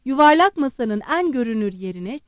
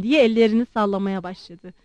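plain reading voice

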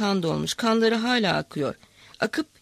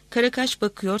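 usual speaking voice

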